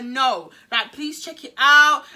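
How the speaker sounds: loud, slow pleading to the nurse; insistent plea. Retold or imitated. Teen begging the nurse to examine her, loud and slow. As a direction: press the nurse to check, raising the voice.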